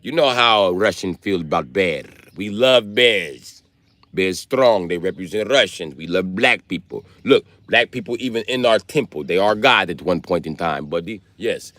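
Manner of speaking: put-on a lightly done video game Russian accent; mock Russian accent. Comic still in the Russian bit, light mock Russian accent. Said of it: "My Russian accent came from Call of Duty".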